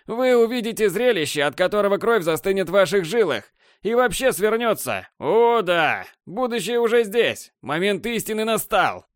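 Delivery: высокое театральное вещание; вещай с надрывом, как пророк апокалипсиса; надрывный пафос. Acted. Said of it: "вещал Борланд с надрывным пафосом". (надрывный пафос)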